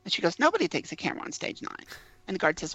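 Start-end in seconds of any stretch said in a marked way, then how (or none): 0.4-1.9 higher, quick office lady's brush-off; desk lady. Retold or imitated. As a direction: toss it off fast and higher as the lady. Desk lady repeats the rule.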